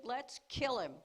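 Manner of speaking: loud, hard plotting shout; angry brothers. Acted. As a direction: bark out the plan to kill. Brothers plotting murder, acted.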